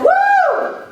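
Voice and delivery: a high, loud whoop of shock; mock shocked woo. Coach reacting to woman's awful boss, high loud woo. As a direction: whoop like the story floored you.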